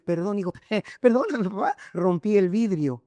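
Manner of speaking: imitating a boy laughing through "perdóname"; mock sorry with a laugh breaking in; giggling apology. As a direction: insincere apology, laughing mid-word. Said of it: "se estaba deleitando".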